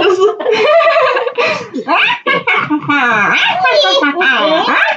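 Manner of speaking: high shouted cackle, half choking; witch laugh. Pretend. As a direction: try a witch cackle and choke on it. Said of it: "give us your best witch laugh".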